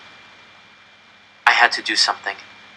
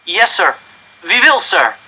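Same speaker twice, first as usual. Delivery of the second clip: nervous obedience; 8 semitones higher, 5 dB louder, slower than usual. Acted. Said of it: "I said".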